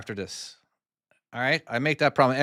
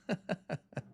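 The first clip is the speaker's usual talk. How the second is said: quiet, high little laugh; soft chuckle; 7 semitones higher, 12 dB quieter than usual. Real, not acted. Streamer amused by a chat comment, soft laugh. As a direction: chuckle softly to yourself.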